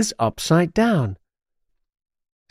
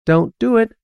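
higher-pitched magpie warning; urgent warning; 9 semitones higher than usual. A narrator's reading. Pet magpie warning the witch, high and urgent. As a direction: warn in a high little bird voice. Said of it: "warns Quip".

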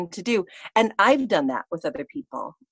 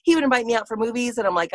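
ordinary delivery

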